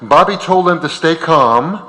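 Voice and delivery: usual speaking voice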